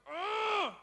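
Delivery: put-on high, exasperated parent fed up with the kids; frustrated groan. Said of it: "when you are frustrated and you're like".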